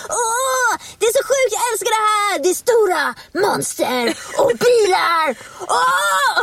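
high, hammed-up squeals of fake enthusiasm; mock over-excitement. Mimicking a host faking hype over an action trailer, hammed-up excitement. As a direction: gush far too hard, stretching the big words into mock shrieks. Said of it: "jätteengagerad i någon sån här ny action-trailer".

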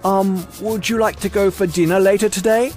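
put-on high, hesitant British-accented voice. A narrator's reading. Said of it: "I loved his British accent".

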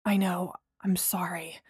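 sorry in a whisper; whispered apology. A narrator's reading.

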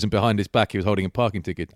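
usual speaking voice

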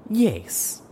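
high, drawn-out, falling word; drawling ladylike sigh. A narrator's reading. Unicorn answers pegasus about trust, drawling sigh. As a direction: sigh it out like a lady. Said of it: "in a drawling yet ladylike sigh".

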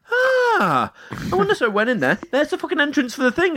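high, excited realisation; mock-amazed discovery; excited gasp.

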